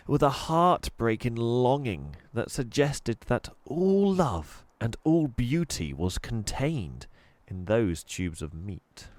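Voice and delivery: ordinary delivery, nothing marked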